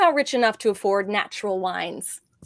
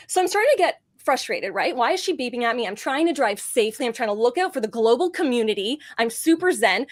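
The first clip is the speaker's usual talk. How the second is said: normal talking voice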